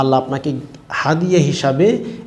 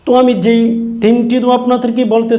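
high, raised sermon delivery; impassioned preaching; 9 semitones higher, 4 dB louder than usual. Real, not acted. Preacher urging listeners to make this dua, impassioned preaching. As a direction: lift the voice to urge the congregation.

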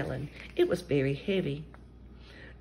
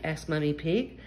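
plain reading voice

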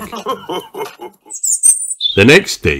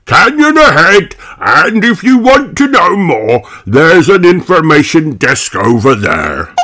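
Mister Bison; 9 semitones higher, 4 dB louder than usual. Acted. Mister Bison warns drivers of the canyon, emphatic. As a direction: project it like a roadside warning.

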